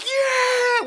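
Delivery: high-pitched cheering "Yeah!"; re-enacted burst of hype, voice way up; hyped up. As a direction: whoop it like a fan at the screen. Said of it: "We were fucking hyped".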